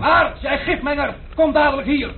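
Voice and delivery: high-pitched furious calls; angry yelling. Acted. Impatient guest shouting down for the innkeeper, high and furious. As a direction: bellow for the innkeeper from upstairs.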